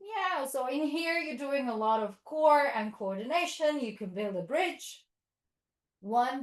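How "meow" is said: short, much higher meow; a quick happy kitty meow; playful cat sound for kids. Shown as a demonstration. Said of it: "tiger's paws".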